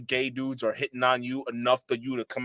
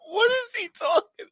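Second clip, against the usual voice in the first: very high squeal through laughter; helpless laughing disbelief; 6 dB louder, slower than usual. Real, not acted. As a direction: crack up while asking. Cracking up at the clip.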